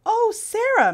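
gushing. A narrator's reading. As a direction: gush over her name with delight. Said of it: "Missus Burton gushed".

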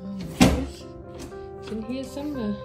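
ordinary talk, nothing marked

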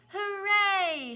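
high, loud cheer of hooray; children shouting. Acted. Children cheer as Mac pulls the wagon, high loud hooray. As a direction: cheer it out like excited kids. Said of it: "shouted the children".

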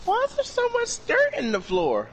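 high-pitched puzzled mother; hammed-up high mom question; mock mom voice. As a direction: imitate a mom questioning her son in falsetto. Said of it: "his mom walks into his room".

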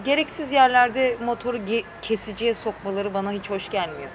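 ordinary delivery

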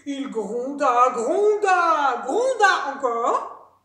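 loud, slow, drawn-out repeats; booming storyteller swelling with each repeat; rumbling. A narrator's reading. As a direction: roll each word out like thunder. Narrator mimes the rumbling.

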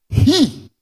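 HE cried high and very loud; emphatic shout. Real, not acted. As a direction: belt out HE from the pulpit. Preacher cries out one word.

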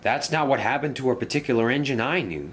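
usual speaking voice

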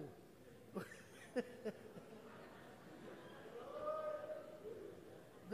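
high airy giggle; short breathy laugh. Real, not acted. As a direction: let a breathless laugh slip out. Laughs mid-sentence at herself.

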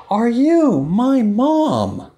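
slow, high, hopeful question; little duckling voice. Acted. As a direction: ask with wide-eyed hope. Dylan asks again.

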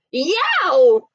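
loud sudden yow of surprise; father yelping on a spiky chair; startled yelp. Acted. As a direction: yelp as if you sat on something sharp. Papa sits on dinosaurs.

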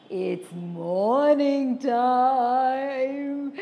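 troll gloating over his meal. Acted. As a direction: relish the thought of breakfast. Troll speaks.

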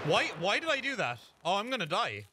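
high, dismayed self-reproach; real alarm. Real, not acted. Player realising a blunder may be fatal, alarmed. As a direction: wail it at yourself, pitched well up.

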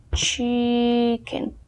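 "Chicken" with a long drawn-out first syllable; slow, careful model of "Chicken"; slow pronunciation demo. Shown as a demonstration. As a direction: stretch the "chi" of "Chicken" for learners. Teacher models the word slowly.

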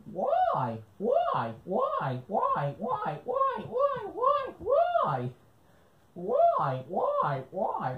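put-on very high, nagging little-kid why; squeaky pestering kid voice; whiny kid voice. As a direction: pester him like an annoying child. Pestering kid character repeating why.

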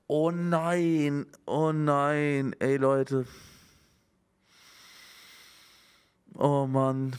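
long drawn-out dismayed groaning words. Real, not acted. Streamer reacts to bad news about a colleague, drawn-out dismay. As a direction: draw out each word in dismay. Sees the article is from today.

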